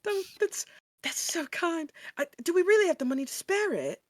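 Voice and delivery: high, quick and soft; a shy girl touched by the offer; sweet girlish voice. Acted. Penelope thanking Salem, high and bashful.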